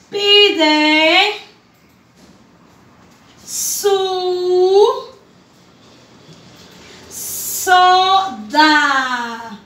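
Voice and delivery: each word read alone with long pauses; "Pide" "su" "soda" spaced out for children; syllable by syllable. Shown as a demonstration. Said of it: "La primera oración dice".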